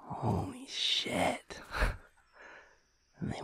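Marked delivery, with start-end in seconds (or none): 0.1-1.6 high, slow breathless gasp of wonder; awed surprise. Acted. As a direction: stretch it out, stunned by the view. Arriving at the scenic view.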